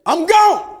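high, loud "I'm gone"; deserting parent's shout; loud mock exit. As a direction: announce leaving loudly. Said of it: "Walk out of the house and say".

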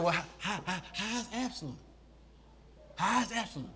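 hushed, halting, faltering question; imitating tearful David. Crying David asking after his son, acted tearfully. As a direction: stammer it very softly, as if still crying. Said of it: "David was still crying".

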